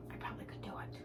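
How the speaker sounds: whispered aside. Real, not acted. To the viewers about her family, a whispered aside. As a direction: drop to a breathy whisper for the aside.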